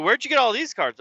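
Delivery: high, pointed question; suspicious dad. Retold or imitated. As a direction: demand to know like a dad. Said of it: "It's like".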